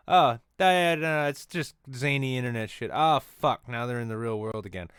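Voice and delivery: loud sarcastic mock of dismissers; scoffing then alarmed exclamation; mock dismissive voice. Mocking dismissive people then shock.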